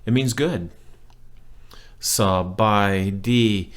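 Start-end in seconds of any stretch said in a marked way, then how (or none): 2.0-3.7 "Sub by D" slow and drawn out; slow teaching demonstration of "Sub by D"; word by word. Shown as a demonstration. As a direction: space out each syllable of "Sub by D". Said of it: "word by word".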